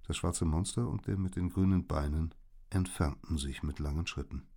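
plain reading voice

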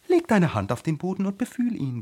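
high, emphatic flower order; imperious high-voiced tiger lily; commanding. A narrator's reading. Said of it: "befahl die Tigerlilie".

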